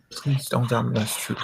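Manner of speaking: usual speaking voice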